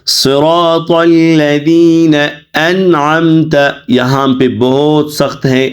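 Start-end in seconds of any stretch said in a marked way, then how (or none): 0.1-3.6 slow, measured recitation with drawn-out vowels; Quran recitation. Shown as a demonstration. Teacher models the verse for students, slow recitation. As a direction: recite slowly and evenly, stretching long vowels.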